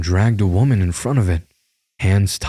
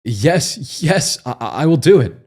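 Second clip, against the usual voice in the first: desperate; 7 semitones higher, slower than usual. Acted. Forced agreement under threat.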